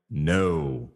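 low, drawn-out groan of disappointment; dismayed no. Retold or imitated. Host voicing fans let down by the quarterback, dismayed. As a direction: let the no sink low and slow. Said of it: "at times you're like".

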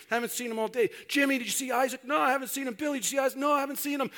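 high-pitched, hurried question-and-answer voices; acted frantic camp search, pitched high; frantic search exchange.

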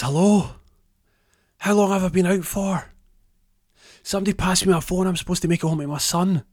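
high, loud, urgent calling out; panicked waking patient. Acted. Strapped-down man calls to whoever is there, panicked and high. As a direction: call out for help, pitched right up. Tim wakes strapped to a bed.

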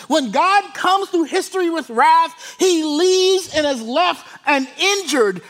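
loud, high-pitched sermon delivery; full-force preaching. Real, not acted. Preacher declaring God's judgment on evil, full force. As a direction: drive it out loud, stretching the key words.

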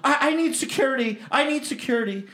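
high-pitched mock panic; hammed-up high flustered cry. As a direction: imitate a flustered woman calling for help. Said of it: "She gets all flustered".

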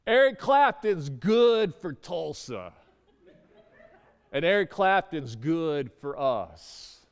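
slow, emphatic mock speech to a crowd; grand proclamation. Homeless man vouching for his friend to buddies, imitated slow proclamation. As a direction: imitate a slow, booming endorsement.

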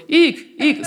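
very high, loud yelps; prey cries. Retold or imitated. Imitating panicky prey, very high and loud. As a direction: squeal like frightened prey. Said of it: "that sounds like prey".

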